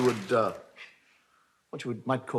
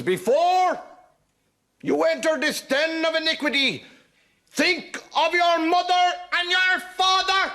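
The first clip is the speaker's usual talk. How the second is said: high, slow prim nun warning; a shrill-free but very high stern nun scolding; 7 dB louder, much slower than usual. Acted. Comic nun warning the little fellow. Joke, nun voiced by teller.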